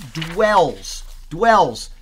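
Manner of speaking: weighty, deliberate stress on "dwells"; emphatic. Real, not acted. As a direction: land hard on "dwells", slowly, then repeat it forcefully. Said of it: "Those are all emphatic in the Greek".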